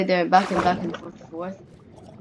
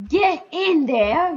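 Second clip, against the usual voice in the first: slow high mock command; 7 semitones higher, 3 dB louder, much slower than usual. Stuffing item away.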